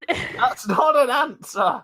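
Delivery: high, slow, indignant yell; exasperated shout. Acted. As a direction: drag it out in frustration. Arguing with hermit through chimney.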